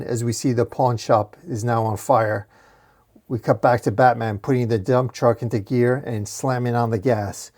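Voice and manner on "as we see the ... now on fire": ordinary delivery, nothing marked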